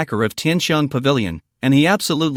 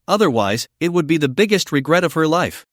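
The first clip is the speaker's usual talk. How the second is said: ordinary delivery, nothing marked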